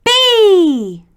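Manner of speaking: high, loud single shout; children shouting out their letter. Acted. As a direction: call it out to everyone. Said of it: "the children cried".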